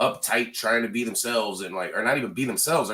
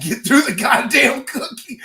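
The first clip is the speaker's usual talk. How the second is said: laughing; 11 semitones higher, 7 dB louder, slower than usual. Real, not acted. Laughing about hard cookie.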